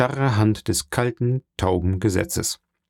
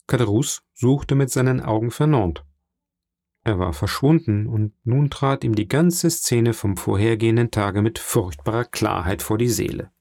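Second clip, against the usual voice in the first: ordinary delivery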